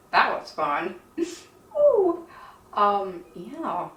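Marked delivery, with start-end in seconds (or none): 0.1-2.3 sarcastic. Real, not acted. Sarcastic after painful redressing.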